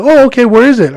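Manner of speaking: feminized guy. Retold or imitated. Tall customer asks the driver about his package, lightly feminized. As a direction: imitate the guy with a feminized lilt. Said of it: "I feminized it a little bit".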